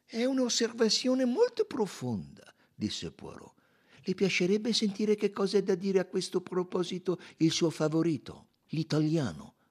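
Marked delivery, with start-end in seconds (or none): 0.1-2.5 high-pitched Poirot; high voice for the detective; Poirot, composed. A narrator's reading. 4.0-9.6 high-pitched detective, politely asking; high Poirot voice; Poirot, inquiring. A narrator's reading.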